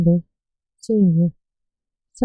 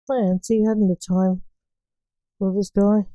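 usual speaking voice

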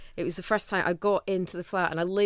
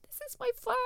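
delighted; 4 dB quieter than usual. Retold or imitated. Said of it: "a week just going".

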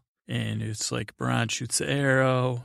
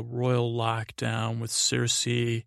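usual speaking voice

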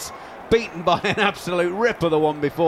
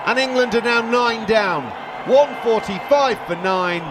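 excited commentary; 9 semitones higher than usual. Real, not acted. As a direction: shout the breakthrough as it happens. Live call of a wicket.

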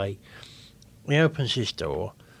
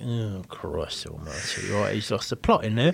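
dismayed thought; 6 semitones lower, 6 dB quieter than usual. Retold or imitated. Waiting customer hearing the cook lose the plot, hushed dismay. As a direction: think it aloud under your breath.

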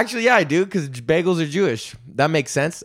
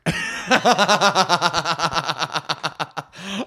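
long rattling laugh; 2 semitones higher than usual. Real, not acted. Host admits going to Trinity Bellwoods Park, long laugh. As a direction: laugh long at your own confession.

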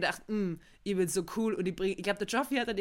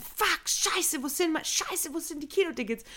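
higher, mock-panicked ticket search; imitating panic; 8 semitones higher than usual. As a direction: play the friend's faked panic, pitched up. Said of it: "er so panisch".

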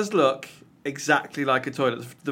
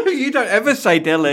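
laughing; 10 semitones higher, 8 dB louder than usual. Real, not acted. Laughing at the dilly ding game.

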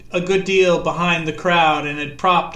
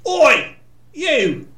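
higher, louder taunting "Oi! You!"; bully calling across the field; sneering call; 9 semitones higher, 5 dB louder than usual. A narrator's reading. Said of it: "he sneered".

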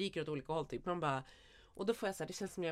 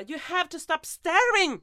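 very loud, high, slow yell in English; retold outburst; 10 semitones higher, 12 dB louder, much slower than usual. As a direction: snap at a staring stranger at full volume. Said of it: "så då skrek jag till". (återberättat utbrott, skriker långsamt och högt)

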